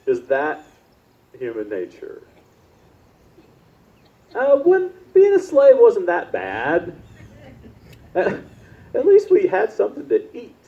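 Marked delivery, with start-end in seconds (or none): 4.3-10.5 high, slow whiny grumble, a touch of laughter; complaining Israelites. Pretend. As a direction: whine about the good old days of slavery. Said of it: "start complaining".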